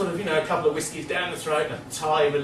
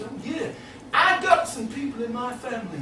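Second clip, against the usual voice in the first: higher, sly mock backwoods voice; the uncle; 7 semitones higher, 4 dB quieter than usual. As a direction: gossip at the store counter. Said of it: "and he says".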